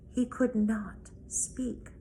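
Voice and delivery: ordinary delivery, nothing marked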